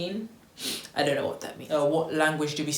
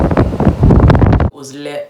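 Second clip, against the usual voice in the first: much louder, very slow, drawn-out hype; hyped up; 16 dB louder, much slower than usual. Real, not acted. Telling viewers about her trip home, loud slow hype. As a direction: stretch it out, bursting with excitement.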